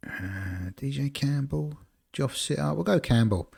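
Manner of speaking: ordinary talk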